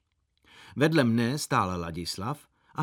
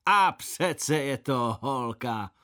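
very slow, drawn-out teasing in a different voice; teasing gentlemen; 2 semitones higher, much slower than usual. Acted. Laughing gentlemen teasing the little boy, very slow and drawn out. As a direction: drag it out, amused and mocking. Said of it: "Páni se smáli a říkali".

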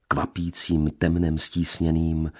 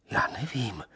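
timid denial; 6 semitones higher, 6 dB quieter than usual. A narrator's reading. Lea's frightened denial.

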